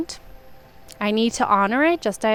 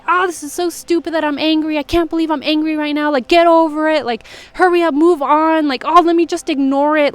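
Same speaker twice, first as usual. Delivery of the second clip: higher, louder, impatient self-talk; mock self-scolding; 6 semitones higher, 4 dB louder than usual. Telling yourself not to be angry, mock impatient scolding. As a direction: imitate an exasperated inner critic. Acts out self-shaming inner voice.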